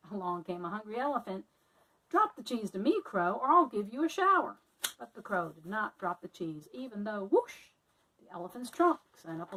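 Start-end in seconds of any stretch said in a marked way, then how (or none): 2.1-4.6 louder, higher blustering threat; bully the crow for the cheese; threatening elephant. Acted. Elephant threatening the crow, loud bluster. 7.3-7.7 high loud whooshing sound. Acted. Elephant spraying water, loud whoosh.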